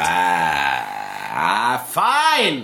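comic exasperated outburst; mock exasperation. Real, not acted. Gives in to a dare.

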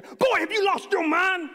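loud, high, drawn-out outrage; outraged dad shout. Retold or imitated. Dad out of his chair at his defiant son, outraged shout. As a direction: explode at the boy in a slow, high shout. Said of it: "I am up out of my chair".

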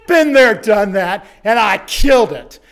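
father boasting to his son after the marathon; triumphant boast. Retold or imitated. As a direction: crow it like a victory lap.

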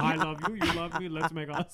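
a hint of a bad Ukrainian accent, giggling. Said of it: "I know that was a terrible Ukrainian accent".

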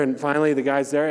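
ordinary talk

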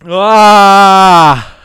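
loud burning exhale; pained gasp. Real, not acted. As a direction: gasp at the spicy heat. Burning mouth from the chip.

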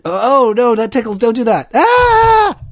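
high squeals while tickled; being tickled. Real, not acted. Partner rubs his foot.

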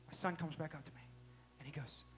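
ordinary talk, nothing marked

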